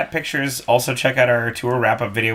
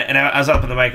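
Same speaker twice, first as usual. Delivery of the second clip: usual speaking voice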